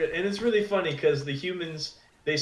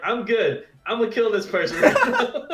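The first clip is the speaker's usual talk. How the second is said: higher and louder, a hammed-up killer threat through laughter; laughing mock threat; 6 semitones higher, 5 dB louder than usual. Hitmonchan insulted by Charmander, laughing mock threat. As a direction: play a comic over-the-top threat, cracking up.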